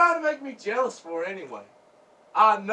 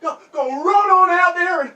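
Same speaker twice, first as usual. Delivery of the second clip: loud, high-pitched angry goading; jealous shouting; 7 semitones higher, 10 dB louder than usual. Acted. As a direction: yell at her to get out there. Jealous man orders her outside.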